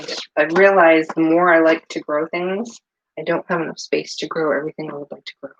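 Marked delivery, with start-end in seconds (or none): none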